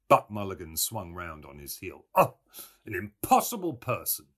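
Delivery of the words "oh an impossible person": louder exasperated exclamation; exclaimed. A narrator's reading. Mulligan giving up on Stephen, exclaiming. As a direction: throw it out in exasperation. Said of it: "he exclaimed".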